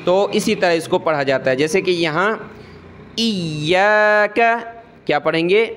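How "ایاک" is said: ایاک with the یا held hard and doubled, slow. Shown as a demonstration. Teacher showing pupils the shadda, ایاک with heavy doubled یا. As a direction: press and hold on the یا in ایاک, slowly. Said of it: "یعنی یا پر سختی کر رہا ہوں".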